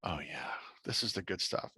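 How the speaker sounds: low, hushed savoring; soft, low inner voice; quiet relish. Retold or imitated. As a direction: murmur it to yourself, pleased with the find. Savoring her own writing in thought.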